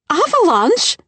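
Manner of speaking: high, loud shrieked question; hysterical scream. Acted. Frightened guest screaming at the news, high and loud. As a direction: shriek it in panic. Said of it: "a woman screamed hysterically".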